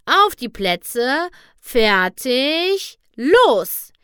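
drawn-out countdown building to a loud shout; starting call. A narrator's reading. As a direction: hold the suspense, then burst out on the last word. Starter calls the race off.

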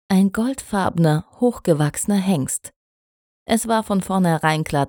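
ordinary delivery, nothing marked

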